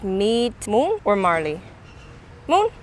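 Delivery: usual speaking voice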